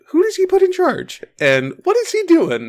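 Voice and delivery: mock bewildered questioning. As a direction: blurt it out like a baffled viewer. Acting out a viewer's puzzled questions.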